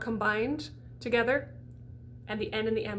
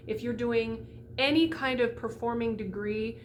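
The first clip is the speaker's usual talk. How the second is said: ordinary talk, nothing marked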